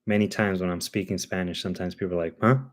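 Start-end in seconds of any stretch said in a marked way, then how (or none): none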